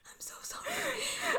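high, slow whispered apology; mortified apology. Real, not acted. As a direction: squeak out a tiny, whispery sorry. Mortified apology to crying friend.

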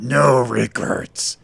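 slow, gravelly growl. Acted. Tattooed attacker repeats the tattoo's words, growling. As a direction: growl it slowly, with menace. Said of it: "Berkey growled".

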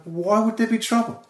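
high, innocent mock-puzzled question; naive surprise. Comic asking the Belfast cab driver, mock innocent. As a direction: play it wide-eyed and clueless. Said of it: "I was like".

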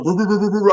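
playful mock dog barks; dog barking imitation. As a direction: imitate the neighborhood dogs barking.

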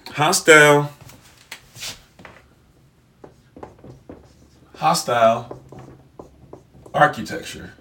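hostile architecture spelled out word by word with long gaps; drawn out as if writing it on a board; slow dictation. Shown as a demonstration. Writing the term out for viewers, slowly dictated. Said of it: "Let me just write that out for you".

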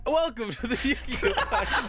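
high-pitched show opener; hammed-up host welcome; mock podcast intro. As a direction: announce it through laughter.

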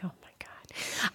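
breathless whisper of disbelief; whispered shock; whispered oh my God. Retold or imitated. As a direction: mouth it in a stunned whisper. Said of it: "And I'm like".

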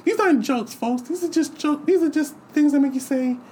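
high squeaky lisping Tyson voice, way up; Mike Tyson impression. Tyson doing his monologue to the crowd, in a high squeaky voice. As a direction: mimic Tyson's high voice hard. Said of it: "It is like".

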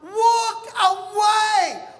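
drawn-out mock lament; mock-anguished declaration. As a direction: ham up the first option. Dramatic option listing.

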